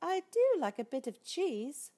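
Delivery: very high, dainty mouse voice; tiny mouse asking for tea; polite little mouse. Acted. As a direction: squeak it out politely. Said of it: "said the mouse".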